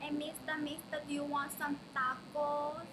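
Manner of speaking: slow, hammed-up Tonga accent street seller. Shown as a demonstration. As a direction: hawk it slowly in a Tonga-style Spanish-tinged accent. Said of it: "this is my Tonga accent".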